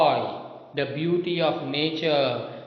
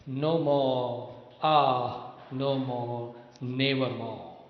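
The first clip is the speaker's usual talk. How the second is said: growing softer and lower toward nevermore; fading lament; 3 semitones lower, 4 dB quieter than usual. Acted. Teacher ending the stanza for the class, fading mournful recitation. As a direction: let each no more die away.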